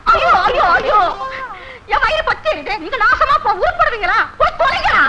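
high-pitched cursing lament; exasperated wailing. Acted. Mother learns boys saw another woman, high exasperated wail. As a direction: cry out in dismay, then curse them.

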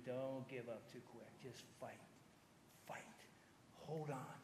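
hushed, low and slow encouragement; gentle plea. Real, not acted. Preacher to struggling marriages, quietly pleading. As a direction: urge them softly, almost under the breath.

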